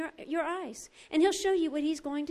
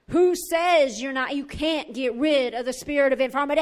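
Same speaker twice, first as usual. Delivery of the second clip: forceful challenge; 6 dB louder than usual. Retold or imitated. Preacher voicing the Lord's challenge inside her, loud and forceful. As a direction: throw down the challenge loudly.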